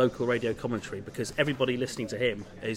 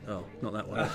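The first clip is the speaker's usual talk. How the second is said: mock disappointment; 5 semitones lower, 5 dB quieter than usual. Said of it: "I tried very hard not to go".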